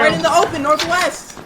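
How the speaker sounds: high, loud callout; urgent shout. Real, not acted. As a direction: yell the direction. Answering where, urgent.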